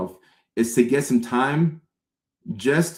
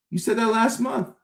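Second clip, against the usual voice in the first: accusing friend; 11 semitones higher than usual. Retold or imitated. Friend doubts tiredness.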